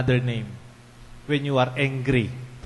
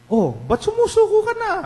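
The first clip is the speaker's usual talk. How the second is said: very high, loud acted reproach; incredulous parent, voice shooting up; exasperated parent; 6 dB louder than usual. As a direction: challenge your child in a shrieky high pitch. Acted parent confronting a child.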